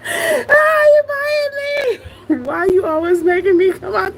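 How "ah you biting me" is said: high mock howl; imitated scream. Reactor replays the bitten man's cry, high mock scream. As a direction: mimic his pained cry for laughs. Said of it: "heard that man start screaming".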